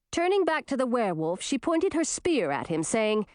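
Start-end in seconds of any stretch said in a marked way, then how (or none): none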